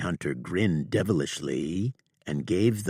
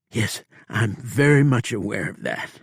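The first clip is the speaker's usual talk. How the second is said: dead sober; 5 semitones higher, 6 dB louder than usual. Acted. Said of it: "dead sober".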